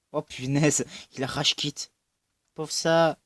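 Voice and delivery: loud, real exclaiming burst; excited outburst. Real, not acted. Gamer shouts as another player rage quits, excited. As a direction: burst out at the screen.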